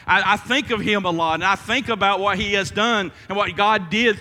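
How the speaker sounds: impassioned sermon climax; full-force preaching. Acted. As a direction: preach it with fire.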